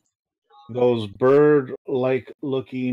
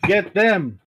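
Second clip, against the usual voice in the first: high-pitched yell, each command falling off; yelling halfling; 7 semitones higher, slower than usual. Acted. Halfling ordering the attack on intruders, high yelling. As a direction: yell urgent orders in a raised, higher voice. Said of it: "the halfling yells at you".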